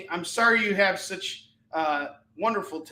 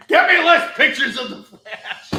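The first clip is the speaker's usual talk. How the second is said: mock demand; 5 semitones higher, 10 dB louder than usual. Joking plea.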